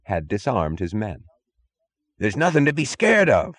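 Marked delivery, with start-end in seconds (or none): 2.2-3.5 higher, pushed mock yell; mild scream. A narrator's reading. Chief yelling to reassure himself, lightly voiced. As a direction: shout down his own panic. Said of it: "He was screaming it to himself".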